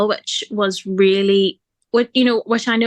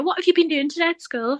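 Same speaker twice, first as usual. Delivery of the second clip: higher, sing-song mum question; mum asking child; 8 semitones higher than usual. Retold or imitated. Mum asking Aria about school, higher parental voice. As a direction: ask it like a parent to a small child. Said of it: "whenever I ask Aria".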